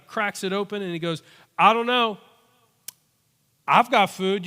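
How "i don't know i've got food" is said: put-on higher, louder eager child; sweet little kid. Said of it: "like a sweet little kid".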